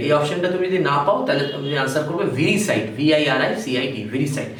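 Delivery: usual speaking voice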